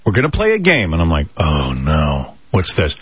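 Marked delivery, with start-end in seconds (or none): 0.0-0.9 put-on high, sing-out call to children; upbeat kid-wrangler. Friend working the kids at dinner, high upbeat announcement. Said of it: "she knows how to work the kids". 1.4-3.0 low, sinking reaction; mild acted dismay, under the breath; retold dread. Dad hearing a game proposed, low mild dread.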